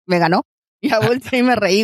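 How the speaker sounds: ordinary delivery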